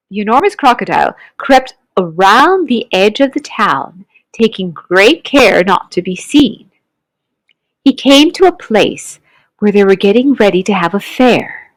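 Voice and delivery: usual speaking voice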